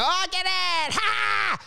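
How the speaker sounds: high mock shout of sudden understanding; mock realisation. Comic imitating audiences who finally get a joke, mock shout. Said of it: "they'd go".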